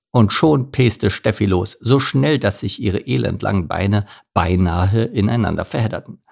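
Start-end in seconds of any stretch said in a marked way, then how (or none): none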